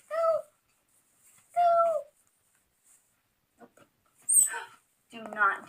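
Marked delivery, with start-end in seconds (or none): none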